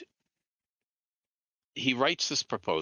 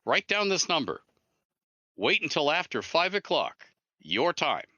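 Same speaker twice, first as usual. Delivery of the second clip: higher, instructing caller; caller's voice; 7 semitones higher than usual. Retold or imitated. Jeff telling Ed when to call, higher voice. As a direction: lift the voice, give the instructions. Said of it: "and he goes".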